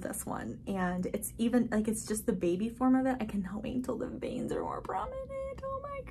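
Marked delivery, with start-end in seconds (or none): 3.2-5.3 whispering. Real, not acted. Excited whisper about veins. 5.6-6.0 excited. Real, not acted. Thrilled at plant.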